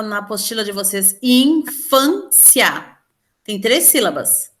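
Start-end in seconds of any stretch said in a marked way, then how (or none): 1.2-3.0 INFÂNCIA very slowly, split in-fân-cia; syllable by syllable. Shown as a demonstration. Teacher showing the class syllable division, slow syllable by syllable. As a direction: break Infância into three separate beats, in... fân... cia. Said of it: "faça a separação silábica".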